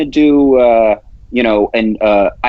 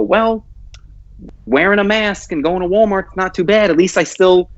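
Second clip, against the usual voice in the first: mock meek citizen; 8 semitones higher than usual. Mocking a complacent citizen.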